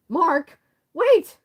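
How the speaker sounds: desperate shout after Mark; calling out. Acted. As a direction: yell it high and loud as he runs off. Character calls after fleeing friend.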